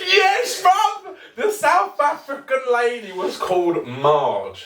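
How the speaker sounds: high-pitched, laughing exclamation; gleeful reveal. Real, not acted. Storyteller revealing the lady's name to co-host, high laughing excitement. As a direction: burst out with it, voice leaping up through laughter.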